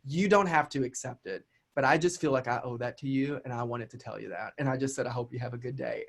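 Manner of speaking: gently rising above the insult; calm gracious reply. Retold or imitated. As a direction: stay composed and polite. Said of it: "I said".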